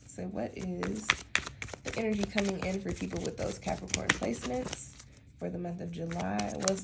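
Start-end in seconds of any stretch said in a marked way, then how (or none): none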